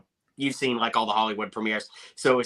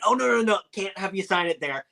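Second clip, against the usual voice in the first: retold protest; 7 semitones higher than usual. Retold protest to Paul Rudd.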